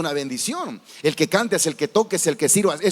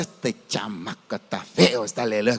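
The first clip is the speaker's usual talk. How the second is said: mock grumble; 11 semitones lower than usual. Preacher voicing an unkind thought at a baby dedication, low sneer. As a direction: growl the insult like a grumpy onlooker.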